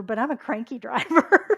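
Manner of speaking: loud, breaking into laughter; laughing confession. Real, not acted. Speaker admitting own crankiness in traffic, laughing. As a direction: own up to it and crack up.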